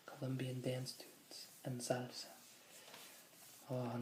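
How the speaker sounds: normal talking voice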